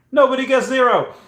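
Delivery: high, loud outburst; excited high-pitched call; exclaiming. Real, not acted. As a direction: cry it out to the chat. Outburst in guessing game.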